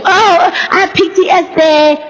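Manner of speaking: high, slow, drawn-out mocking whine; mock whiny complainer. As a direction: parody someone milking their pain. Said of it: "That causes you".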